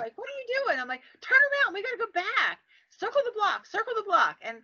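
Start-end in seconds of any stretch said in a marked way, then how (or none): 0.2-0.8 high-pitched startled question; startled retort. Retold or imitated. Friend's startled reply, acted. 1.2-4.4 high, insistent orders to the driver; urgent command. Retold or imitated.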